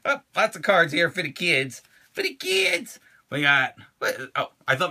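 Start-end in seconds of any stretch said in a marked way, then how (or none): 2.1-3.0 very high, silly little voice; squeaky repeat. Pretend. Echoing himself about cards for kids, squeaky mock voice.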